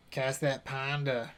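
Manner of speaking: ordinary delivery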